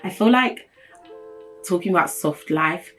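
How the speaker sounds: ordinary delivery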